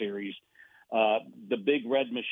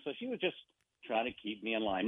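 ordinary talk

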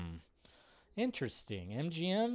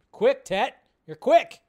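excited; 8 semitones higher, 12 dB louder, slower than usual. Real, not acted. Loud teasing reply to chat.

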